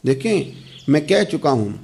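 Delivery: ordinary delivery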